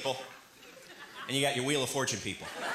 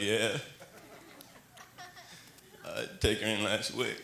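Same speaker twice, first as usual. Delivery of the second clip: low Southern drawl, softer; Texan truck guys; 7 semitones lower, 4 dB quieter, slower than usual. Retold or imitated. Comic imitates two Texas guys at a store, low drawl. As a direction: talk like a slow-drawling good old boy. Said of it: "dude's like".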